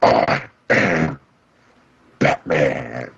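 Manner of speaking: low gravelly mock Batman; deep hammed-up Batman line. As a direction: growl it like Batman. Batman voice bit.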